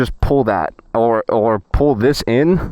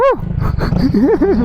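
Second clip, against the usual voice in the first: high laugh. Real, not acted. Laughing at his own bit.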